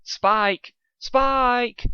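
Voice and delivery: put-on a young colt yelling for his helper; hollering. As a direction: call the name out high. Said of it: "Dustshine hollered for his assistant".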